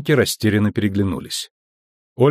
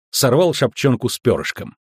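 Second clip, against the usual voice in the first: ordinary delivery, nothing marked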